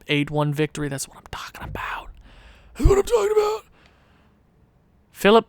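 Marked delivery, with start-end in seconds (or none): 0.9-2.1 hushed, voiceless whisper; whispered celebration. Acted. Gamer savoring a big win, whispered celebration. 2.7-3.6 very high, put-on little voice; squeaky celebration. Gamer savoring a big win, squeaky celebration. Same words again, high voice.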